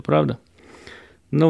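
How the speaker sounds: ordinary talk, nothing marked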